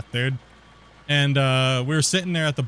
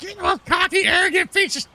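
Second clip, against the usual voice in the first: much higher, shrieking caricature of the officer; mock furious officer; 4 dB louder than usual. Border officer shrieking at him, high mock fury. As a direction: squeal the insult like an outraged official.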